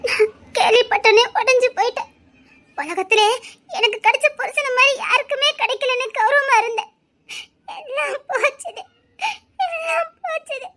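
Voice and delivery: sobbing lament fading to a broken whisper; crying lament. Acted. Wife lamenting to her husband that all is lost, sobbing. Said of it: "புலம்பிட்டு இருக்க".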